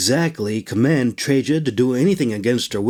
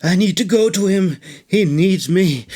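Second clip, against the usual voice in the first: choked, crying, pitched up; broken, tearful rasp; rasping tearful plea; 5 semitones higher than usual. Acted. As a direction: rasp it out through tears, desperate to reach him. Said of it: "she rasped".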